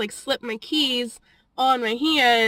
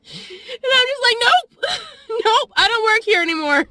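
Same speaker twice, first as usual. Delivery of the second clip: much higher, louder, half laughing half crying; 11 semitones higher, 5 dB louder than usual. Real, not acted. Vlogger telling coworker she was fired, laughing through tears.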